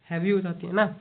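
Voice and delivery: a light demo of a voice worn heavy from shouting; heavy strained voice. Shown as a demonstration. Teacher demonstrating to students a voice gone heavy from shouting, lightly strained. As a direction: let the word sound rough and worn. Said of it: "ज़्यादा चिल्लाने से voice heavy हो जाए ना".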